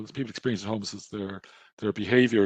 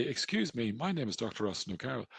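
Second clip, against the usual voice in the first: softer, prim and courteous self-introduction; mock polite middle-class; 8 dB quieter than usual. Doctor imagines a posh introduction to a street aggressor, softer. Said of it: "Whereas if I say".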